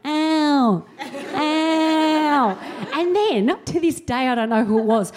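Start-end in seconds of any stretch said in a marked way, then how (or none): 0.1-2.6 mock whimper. Lying hurt after the fall.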